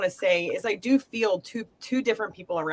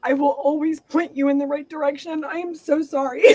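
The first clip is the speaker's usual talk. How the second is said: higher, tearful, cracking into a laugh; voice breaking with emotion; 5 semitones higher than usual. Real, not acted. Mother relays grandfather's message, voice breaking, higher and tearful. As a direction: fight back tears while passing on the message. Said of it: "a lot of emotion come through that".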